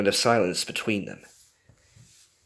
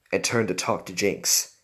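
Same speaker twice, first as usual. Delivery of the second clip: usual speaking voice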